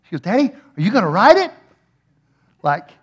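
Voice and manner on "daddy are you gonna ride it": put-on lightly raised, eager child's question; a hint of a pleading little daughter; imitated little girl. Little daughter asking dad to ride the swing, lightly imitated. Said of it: "You're a little bitty girl".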